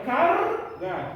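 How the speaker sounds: "cargar" slowly in two pieces, punching a rolled CAR; slow word model. Shown as a demonstration. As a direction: split "cargar" as car-gar with a long trilled r. ("cargar" devagar, separando car-gar, com r vibrado)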